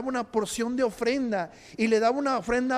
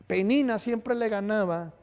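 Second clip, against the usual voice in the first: usual speaking voice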